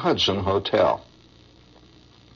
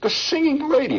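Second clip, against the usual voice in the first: much higher, emphatic second naming; amazed repeat. Retold or imitated. Old soldier repeats her name to listener, amazed. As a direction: say it again, more amazed.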